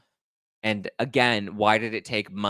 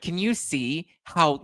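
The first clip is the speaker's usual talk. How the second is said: ordinary talk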